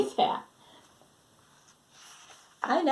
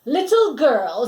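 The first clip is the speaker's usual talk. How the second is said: slow, a bit louder lordly call; a hint of a haughty archduke; pompous archduke; 5 semitones higher, 10 dB louder, slower than usual. Archduke addresses Annabelle.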